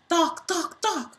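high, loud popping thunks; repeated thunks. Acted. Reader voicing rapid racket hits, loud thunks. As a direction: bounce out each hit.

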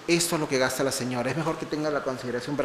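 soft, hushed lawyer's formal notice; quietly imitated lawyer, rushing at the end; mock lawyer. Voicing the lawyer at the door.

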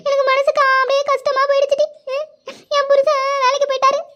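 high, plaintive crying voice; tearful regret. Acted. Akka regretting that she beat her husband, tearful. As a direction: lament it in a high, weepy voice.

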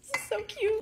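high-pitched delighted coo; gushing. Real, not acted. Creator admiring her drink bar decor, gushing. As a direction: squeal over something adorable.

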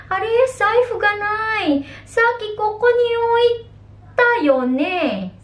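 high, alarmed voice looking for a lost wallet; worried, searching. Acted. Someone missing their wallet, high and alarmed. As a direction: sound puzzled and a bit panicked. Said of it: "Sabi mo, ha?".

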